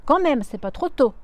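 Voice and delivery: loud, grumpy complaint; grumbling. Acted. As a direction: grumble at the delay. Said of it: "ronchonne-t-elle". (ronchonnement fort)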